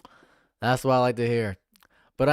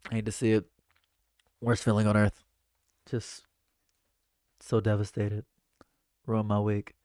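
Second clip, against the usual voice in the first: ordinary delivery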